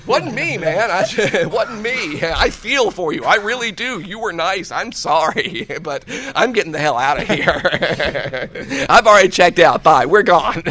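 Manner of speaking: laughing protest. Retold or imitated. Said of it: "And it's like".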